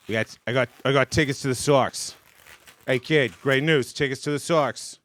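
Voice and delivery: put-on loud Boston accent, tickets to the Sox; a thrilled Boston dad inviting his kid. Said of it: "a Boston accent with him".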